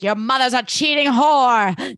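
slow, high mock outrage; theatrical soap-opera denunciation; hammed-up accusation. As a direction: draw out the insult melodramatically. Acted accusation in a recap.

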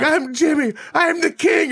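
very high-pitched mock boast; Jimmy the King. Cab driver taunts other drivers, high boastful imitation. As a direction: crow it high, taunting the rivals. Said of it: "he's taunting these other cab drivers".

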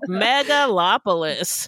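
"Megalopolis" said slowly, syllable by syllable, meg-a-LOP-o-lis; careful pronunciation. Shown as a demonstration.

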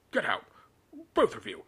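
loud, forceful dismissal; strained, angry command; croaked order. A narrator's reading. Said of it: "crowed Uncle Vernon".

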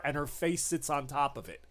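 ordinary talk

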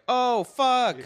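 acted-out surprise. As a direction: act out a pleased surprise, pitch jumping up. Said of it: "I was like".

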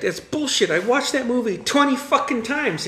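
high, worked-up retold outrage; indignant protest. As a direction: argue back in mock indignation, pitched high. Reenacts indignant film-quiz protest.

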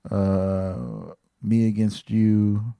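usual speaking voice

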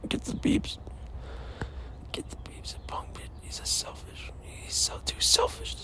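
hushed whisper. Retold or imitated. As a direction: whisper the quote under your breath. Quoting Blaine in a whisper.

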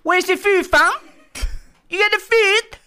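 very high, loud mock gangster voice; Top Boy audition. Actor auditioning for casting agent, squeaky hammed-up gangster voice. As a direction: overact the street slang in a squeal. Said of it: "I wanna be in Top Boy".

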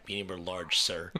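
low, soft, deadpan cashier drone; imitating a fed-up drive-thru worker, low and soft; bored cashier. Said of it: "He's like".

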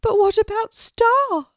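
high, loud, pressing little pelican voice; insistent pelican Moon. Acted. Moon insists to Kelty, high and loud. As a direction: push back hard, high and loud. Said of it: "insisted Moon".

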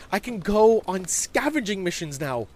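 high, loud boasting; thrilled kid voice; excited young boy. Acted. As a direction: brag to a friend about a new privilege. Boy boasts of new freedom.

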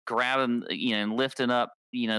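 ordinary talk

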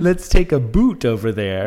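exaggerated Canadian boot vowel; mock Canadian accent. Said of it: "sounded so Canadian".

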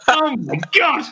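high-pitched, astonished retold outburst; starstruck gasp. Told his guest is Ian Wright, starstruck. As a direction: act out a thrilled, disbelieving reaction.

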